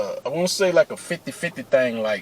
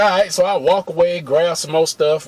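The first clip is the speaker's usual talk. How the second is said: ordinary talk, nothing marked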